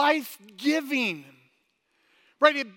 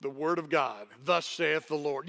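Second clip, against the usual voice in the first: much lower and softer, a grave King James reading; manly Bible voice; 10 semitones lower, 6 dB quieter than usual. Shown as a demonstration. Pastor demos the manly audio Bible voice, deep and solemn. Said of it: "that real manly voice".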